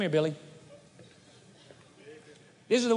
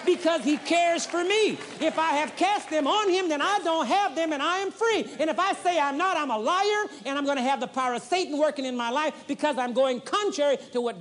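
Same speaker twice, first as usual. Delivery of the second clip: full-force preaching; 6 semitones higher than usual. Acted. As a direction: preach it out with fire.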